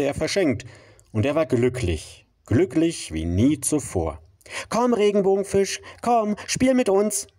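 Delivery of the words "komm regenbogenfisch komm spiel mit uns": high, bright calling out; high calling fish voices. Acted. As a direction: call out playfully in a high voice. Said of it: "riefen die anderen".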